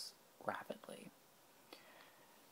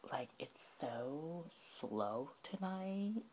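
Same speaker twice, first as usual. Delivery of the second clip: put-on high, slow, drawn-out Valley Girl; sarcastic Valley Girl drawl; 4 semitones higher, much slower than usual. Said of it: "in the Valley Girl voice".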